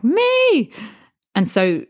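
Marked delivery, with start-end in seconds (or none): 0.0-0.7 eager high "Me!"; excited. Retold or imitated. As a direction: shout it like a keen volunteer. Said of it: "And I was like".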